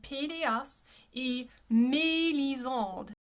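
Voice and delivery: slow Pelleas et Melisande with closed é; "pay-lay-ahs ay may-lee-zahnd" for Pelleas et Melisande; French pronunciation. Shown as a demonstration. Teacher repeats after explaining accents, French pronunciation. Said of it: "gonna close the vowel".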